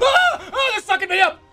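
high, laughing yelp of alarm; real startled yell in the game. Real, not acted. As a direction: yelp as you get sucked up. Sucked up in game.

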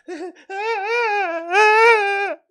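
cackling laugh that breaks into a high whimper; high mock sobbing laugh. Real, not acted. Laughing at his own impression, high and whimpering.

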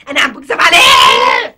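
loud, slow, crying indignation; tearful outraged shout. Acted. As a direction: hurl it back, hurt and furious. Wife offended, shouts tearfully.

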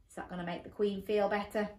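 ordinary delivery, nothing marked

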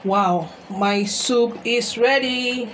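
ordinary talk, nothing marked

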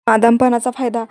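punched out loudly; angry accusation. Retold or imitated. Mukta accusing Savni of exploiting Aditya, loud. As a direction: hammer the charge home.

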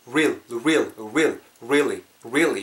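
"real" and "really" starting on a rounded-lip R. Shown as a demonstration. Teacher modelling "really" for learners, rounded-lip R. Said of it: "입술 둥글게 말고".

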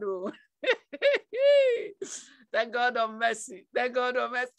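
ordinary talk

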